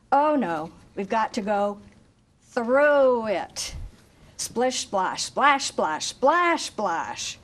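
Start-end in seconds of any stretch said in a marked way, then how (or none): none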